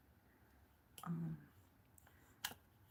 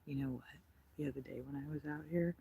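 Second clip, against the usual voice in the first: ordinary talk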